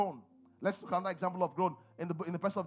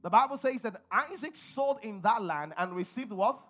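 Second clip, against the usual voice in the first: loud, high, drawn-out pulpit delivery; full-force preaching; 3 semitones higher, 5 dB louder than usual. Real, not acted. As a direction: drive the verse home, cueing the congregation to answer.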